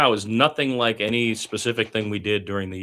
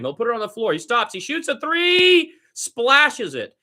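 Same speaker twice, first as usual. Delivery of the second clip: climbing much higher and louder into the made three; excited call; 6 dB louder than usual. Acted. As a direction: build the call up to the splash. Towns hits three during call.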